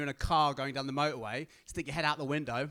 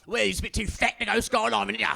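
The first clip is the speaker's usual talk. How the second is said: higher, louder cheeky verdict; mock Aussie instructor; 5 semitones higher, 6 dB louder than usual. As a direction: deliver the punchline deadpan-cheeky.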